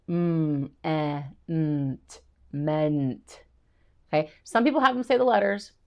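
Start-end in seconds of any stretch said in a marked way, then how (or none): 0.1-3.4 "Mm, eh, mm, t, meant" sound by sound, slowly, then the whole word. Shown as a demonstration. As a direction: segment each sound of "meant", then blend. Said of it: "saying the sounds".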